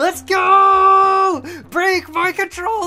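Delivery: high, loud frantic yelling; excited yelling. Real, not acted. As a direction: scream it while mashing buttons. Frantic button mashing contest.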